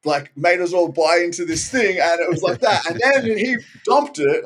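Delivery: sarcastic, exasperated rant; incredulous sarcasm. Real, not acted. Sarcastic retelling of accusation.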